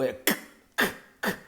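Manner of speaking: voiceless k sound clicked alone, softly; isolated k sound. Shown as a demonstration. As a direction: make only the k sound. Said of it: "é um único som". (som "k" isolado, baixinho)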